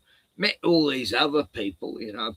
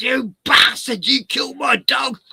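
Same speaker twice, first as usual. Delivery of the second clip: higher, much louder, raging yell; furious shout; 11 semitones higher, 7 dB louder than usual. Retold or imitated. Cain screams at Abel for killing animals, furious. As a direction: scream it at the brother who mocked you.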